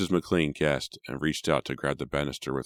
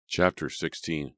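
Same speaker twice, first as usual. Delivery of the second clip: ordinary delivery, nothing marked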